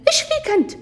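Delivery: high-pitched protest; acted exasperated cry; indignant challenge. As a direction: squeal at him, what's your problem. Girl pushing away from boy.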